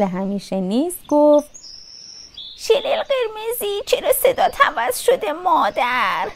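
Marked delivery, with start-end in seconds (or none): 2.6-6.3 high, soft grandmother voice; puzzled grandmother. A narrator's reading. Grandmother doubts the caller at the door, high puzzled voice. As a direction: ask in a high old-lady voice.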